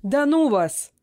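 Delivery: вспыльчиво, громче и выше; сорвись раздражённо; flaring up. A narrator's reading. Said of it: "Вспылил я". (вспылил: «Да ну вас!»)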